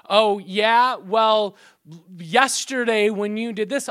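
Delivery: loud, mock comeback jab; combative retort. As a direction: fire back loudly, playing a marital spat. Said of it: "I come back with a retort".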